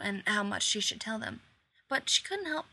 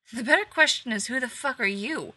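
loud, higher, building outrage; rising angry voice; 3 semitones higher, 8 dB louder than usual. Acted. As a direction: push it up in heat. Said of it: "his voice rising in pitch and loudness".

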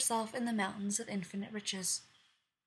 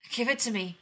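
urgent demand; 6 dB louder than usual. Acted. Queen demands the potion.

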